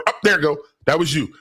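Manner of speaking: ordinary talk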